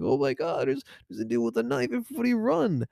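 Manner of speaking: high-pitched, quiet mock alarm; mock panic. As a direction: imitate a panicked crowd, pitch climbing. Imitating panicked partygoers in a slasher.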